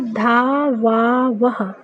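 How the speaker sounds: धा वा वह said very slowly, each syllable apart, ending in "ha"; धा वा वह, slow and spaced, final "ha" sounded. Shown as a demonstration. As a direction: spell out धा वा वह slowly, one syllable at a time. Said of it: "विसर्ग को हा उच्चारित करते हैं".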